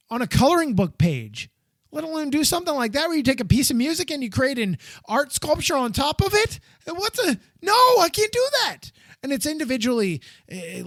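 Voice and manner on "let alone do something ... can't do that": high-pitched, exasperated disbelief; incredulous protest. Real, not acted. Non-artist balking at making art sculpture, incredulous protest. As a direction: protest as if it's absurdly beyond you.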